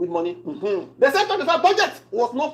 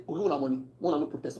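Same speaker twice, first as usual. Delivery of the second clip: mock lawmakers; 9 semitones lower, 8 dB quieter, faster than usual.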